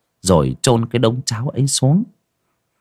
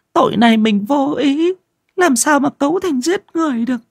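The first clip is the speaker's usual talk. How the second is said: terrified pleading. Acted. Wife begging her husband to turn themselves in, frightened. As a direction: beg him in fear, voice trembling high.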